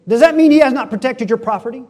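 loud, high, quick demand; acted forceful cross-examination; browbeating question. Jezebel pressing Naboth, acted.

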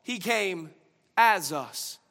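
loud, high preaching voice; fervent sermon delivery. Real, not acted. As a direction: preach it out to the church. Preaching at full force.

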